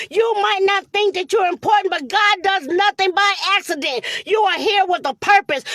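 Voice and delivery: ordinary talk, nothing marked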